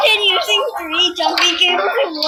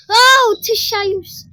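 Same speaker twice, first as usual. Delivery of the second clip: startled; 8 semitones higher, 9 dB louder than usual. Real, not acted. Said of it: "you scared me".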